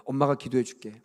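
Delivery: put-on a parent quietly praying over a sick child; gentle parent's prayer. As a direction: pray softly like a parent by the bed. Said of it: "가르쳐 줘야 돼요".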